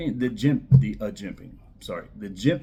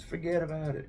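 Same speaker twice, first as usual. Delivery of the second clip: put-on higher-pitched, soft movie-mobster Italian accent; Italian mafia accent; 6 semitones higher, 6 dB quieter, faster than usual. Knife reviewer quoting mafia movies to viewers, Italian accent. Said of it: "In the words of the Italian movies, the mafia movies".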